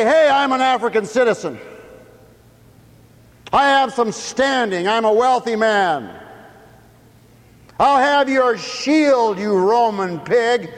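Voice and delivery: loud, high scornful threat, slowing at the end; defiant outburst. Acted. Simon wanting to defy the Roman soldier. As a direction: sneer it at the soldier. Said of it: "wants to ... say".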